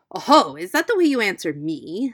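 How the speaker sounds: lightly voiced rough fox. A narrator's reading. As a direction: drop the false sweetness and get rough. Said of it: "speaking very roughly in his natural voice".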